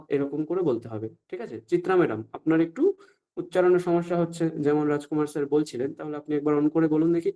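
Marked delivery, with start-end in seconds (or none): none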